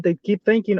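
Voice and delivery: ordinary talk, nothing marked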